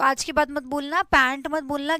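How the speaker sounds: ordinary talk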